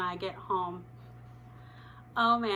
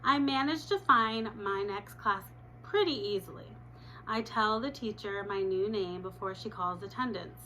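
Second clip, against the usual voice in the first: ordinary delivery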